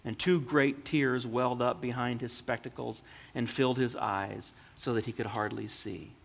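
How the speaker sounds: ordinary delivery, nothing marked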